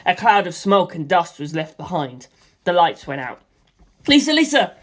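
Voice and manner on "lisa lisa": a loud, raised cry for her; calling out. A narrator's reading. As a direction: shout her name through the smoke. Cries overlap in explosion.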